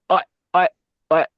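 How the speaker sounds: high, loud flustered stammer; panicked stammer. Acted. Blake stammering when accused.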